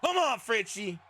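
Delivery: high-pitched rooting cheer; calling out. Real, not acted. Commentator rooting for a tag team wrestler, calling out. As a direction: egg on your favorite wrestler.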